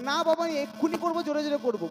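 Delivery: put-on whiny child insisting. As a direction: insist like a stubborn little boy. (বাবার কাছে জেদ ধরা ছোট ছেলে)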